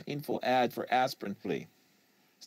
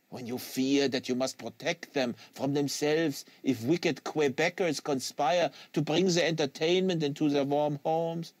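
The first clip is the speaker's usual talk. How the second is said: put-on French Canadian accent; 6 semitones higher than usual. Quebecois agent lecturing the American on fanatic, French Canadian accent.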